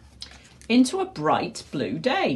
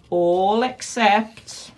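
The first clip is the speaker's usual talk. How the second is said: slow suspense; 4 semitones higher, 5 dB louder, much slower than usual. A narrator's reading. Dramatic suspense pause.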